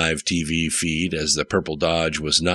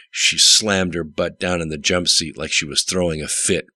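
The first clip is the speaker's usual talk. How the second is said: usual speaking voice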